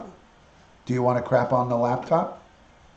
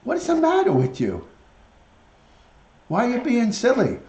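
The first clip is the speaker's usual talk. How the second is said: put-on much higher teasing baby talk; high pet voice; 10 semitones higher than usual. Scolding the silly parrot, high baby-talk teasing. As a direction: tease the bird like a toddler.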